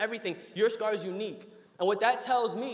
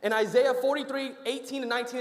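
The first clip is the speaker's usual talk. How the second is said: preaching; 3 semitones higher, 4 dB louder than usual. Acted. Preaching scripture reference.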